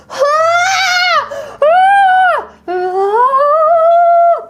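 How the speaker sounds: high, sustained sung "ah"; good luck sound wave. Pretend. Streamer sending a viewer luck, high held cries. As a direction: send out a loud, very high wail of luck. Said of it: "This is my good luck sound wave".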